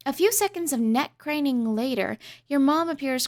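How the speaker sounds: normal talking voice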